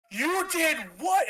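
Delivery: high, slow, disbelieving cry; shocked outburst. Retold or imitated. To the promoter who gave the money away, shocked. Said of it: "And I start, like, freaking out".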